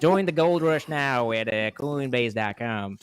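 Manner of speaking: hammed-up prospector accent, slow at the end; mock cowboy ad voice, slowing down; mock Old West accent. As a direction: sell the gold rush like an old-time cowboy. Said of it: "Creepy on point".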